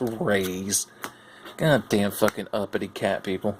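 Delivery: normal talking voice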